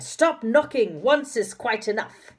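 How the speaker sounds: slow, loud and high angry shout. A narrator's reading. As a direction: bellow at them to stop knocking. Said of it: "A loud voice came from inside".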